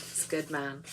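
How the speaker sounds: soft Irish accent on he's a good man. Shown as a demonstration. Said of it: "you have to say with an Irish accent".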